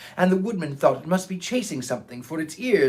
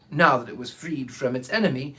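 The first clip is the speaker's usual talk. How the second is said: plain reading voice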